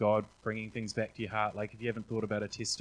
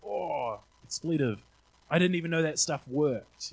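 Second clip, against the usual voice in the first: amazed exclaim; 5 semitones higher, 5 dB louder, slower than usual. Retold or imitated. Friend amazed his thumb is healed.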